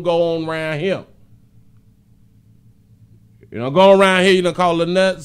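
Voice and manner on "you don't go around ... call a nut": loud scolding; loud stern warning to viewers. Real, not acted. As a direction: lay down the house rules loudly. Scolding the audience.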